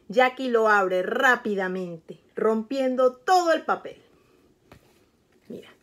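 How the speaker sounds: plain reading voice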